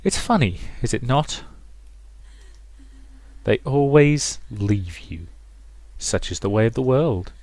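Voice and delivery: quiet menace from a possessive mother. Acted. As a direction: croon it softly, drawing out key words.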